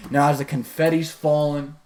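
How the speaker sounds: usual speaking voice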